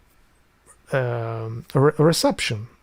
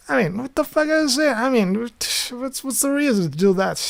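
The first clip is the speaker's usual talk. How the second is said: baffled mockery; 9 semitones higher than usual. Mocking the Albanian sound.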